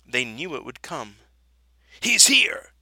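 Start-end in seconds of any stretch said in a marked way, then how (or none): 2.0-2.7 loud, high-pitched cry of arrival; high excited yell; joyful shout. A narrator's reading. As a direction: shout it out to announce the news. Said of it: "they shouted".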